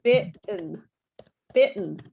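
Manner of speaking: BITTEN as bit'n, caught in the throat; silent middle T. Shown as a demonstration. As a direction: stop short in mid BITTEN, then just N. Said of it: "So it actually sounds like".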